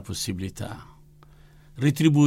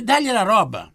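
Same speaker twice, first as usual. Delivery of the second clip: quoted order; 10 semitones higher, 5 dB louder than usual. Retold or imitated. Retelling the nun's order.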